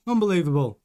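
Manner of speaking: normal talking voice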